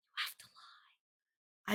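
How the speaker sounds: slow breathy whisper. Shown as a demonstration. Confiding to viewers that they must lie, slow whisper. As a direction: whisper it like a secret.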